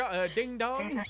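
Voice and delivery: drawn-out ding dong; slow correction. Retold or imitated. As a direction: say it slowly as a correction. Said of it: "He went dick do".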